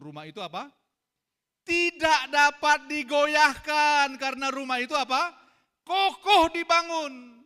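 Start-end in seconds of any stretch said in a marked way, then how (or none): 1.6-7.4 loud, high, slow and ringing; rousing call-out. Real, not acted. Preacher calling out to the congregation, loud and slow. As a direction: lift the whole room with it.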